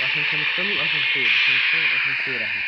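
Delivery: loud, repeated yell. Real, not acted. Yelling repeatedly while playing. (صراخ عالي متكرر)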